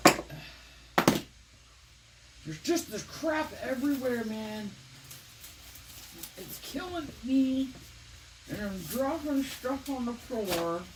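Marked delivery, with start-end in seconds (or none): 2.4-10.8 talking around a paintbrush held in teeth; words round a brush in the mouth. Real, not acted. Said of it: "I'm talking with a paintbrush in my mouth".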